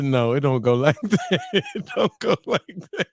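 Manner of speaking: retold refusal breaking into laughter; laughing refusal. Real, not acted. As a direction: start low, then crack up. Retelling refusal, cracking up.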